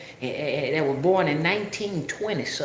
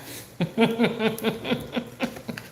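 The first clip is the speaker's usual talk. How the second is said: high wordless laugh; long rattling run of laughter; 6 semitones higher than usual. Real, not acted. Laughs after retelling the mix-up.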